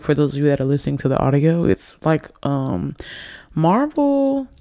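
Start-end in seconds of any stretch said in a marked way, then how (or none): 3.5-4.5 high, playful sing-song marble; tipsy, goofing on the word marble up high; silly high marble. Pretend. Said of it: "Sorry. I'm more than tipsy".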